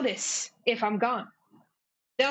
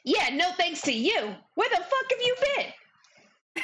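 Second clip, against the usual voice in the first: angry retort; 7 semitones higher than usual. Acted. Lady Morgwart angry at prince.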